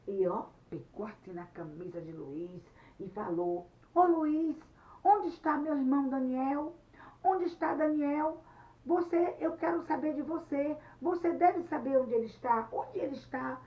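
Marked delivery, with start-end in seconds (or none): 3.9-13.6 loud, high-pitched interrogation; angry demand. Acted. Anete grabs Luiz's shirt about her brother, loudly demanding. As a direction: confront him and demand an answer.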